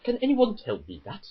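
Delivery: normal talking voice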